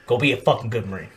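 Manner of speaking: low, soft retold instructor; kill hat's send-off. Kill hat handing over the emblem, low quiet send-off. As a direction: send off a recruit quietly, voice dropped. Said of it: "that shit made me cry because he's like".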